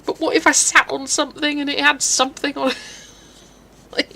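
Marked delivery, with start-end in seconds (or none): 0.0-2.8 higher, louder what-if worry; mock spiraling panic. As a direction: act out irrational panic running away with you. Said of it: "You just start spiraling".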